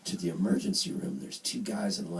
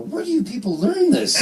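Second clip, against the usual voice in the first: high, loud mock incredulity; retold yell of disbelief; exasperated disbelief; 9 dB louder than usual. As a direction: blurt it out, baffled and exasperated. Acted exasperated retort to nurse.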